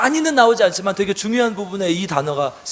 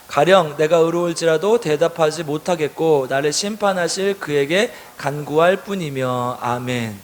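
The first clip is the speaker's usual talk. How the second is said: slow, measured read-aloud verse; slow congregational Bible reading, ending lower; scripture reading; 4 semitones lower, slower than usual. A narrator's reading. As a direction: read the verse slowly for a group to follow.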